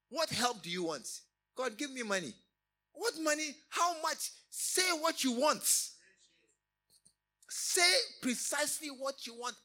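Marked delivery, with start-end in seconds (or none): 1.6-9.6 loud, higher insistent demand; preaching at full force. Acted. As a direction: push them to say exactly. Preacher presses congregation to ask precisely.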